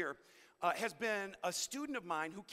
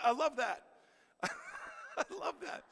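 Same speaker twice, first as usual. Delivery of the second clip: laughing; 9 semitones higher, 4 dB louder, slower than usual. Real, not acted. Laughing about his student.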